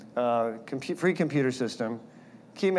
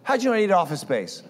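suspicious alarm; 7 semitones higher, 5 dB louder than usual. Retold or imitated. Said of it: "no, I was".

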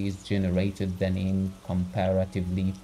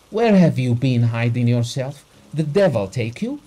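loud, fast raging outburst; fly at the servant; furious shout; 3 semitones higher, 7 dB louder than usual. A narrator's reading. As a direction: Abogin roaring at his footman, loud and fast. Master flies at the footman.

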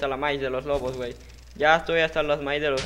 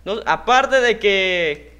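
louder, higher complaint; annoyed rant; 5 semitones higher, 7 dB louder than usual. Real, not acted. Gamer slowed down by an enemy kit, annoyed rant. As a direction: rant at the unfair power.

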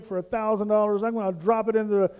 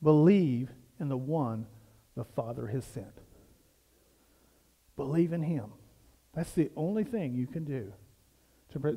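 much lower and softer; quiet, earnest; 7 semitones lower, 6 dB quieter than usual. Real, not acted. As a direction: drop the voice to land the truth. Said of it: "key point of sermon".

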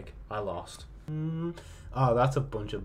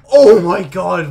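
loud, drawn-out exclamation; stunned outburst; 3 semitones higher, 15 dB louder, slower than usual. Real, not acted. Player reacting to a shot, loud stunned cry. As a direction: cry out slowly at full volume.

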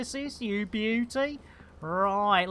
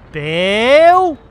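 calling out; 3 semitones higher, 12 dB louder than usual. Real, not acted. Calling the ship AI.

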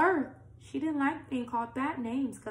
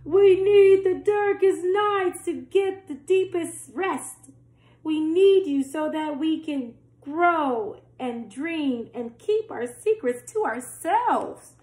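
higher and louder, joyful crowd; rejoicing people; 5 semitones higher, 8 dB louder than usual. A narrator's reading. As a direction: cheer it out like a happy crowd. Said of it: "the people rejoiced".

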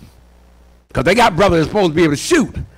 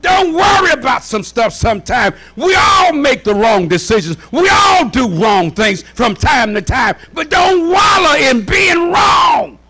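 high, driving pulpit shout; impassioned sermon delivery; full-force preaching; 5 semitones higher than usual. Real, not acted. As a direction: preach it out to the whole church.

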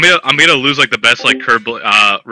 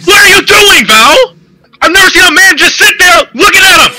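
loud exasperated shouting; raging gamer; 5 semitones higher, 4 dB louder than usual. Real, not acted. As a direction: yell it out in total disbelief. Raging at teammate Val.

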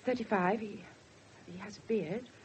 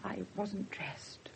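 slow, lower, whispered; ashamed whisper; 6 semitones lower, 10 dB quieter, much slower than usual. Acted. Mother admits to the inspector in a shamed whisper. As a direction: shrink into the words in shame.

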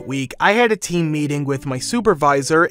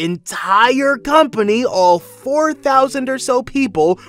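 high, drawn-out emphasis on the scale; incredulous emphasis; 9 semitones higher, slower than usual. A narrator's reading. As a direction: stretch it out high, amazed at the overreaction. Indignant at company-wide memo.

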